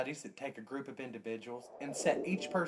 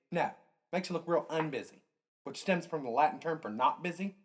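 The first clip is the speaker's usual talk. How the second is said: ordinary talk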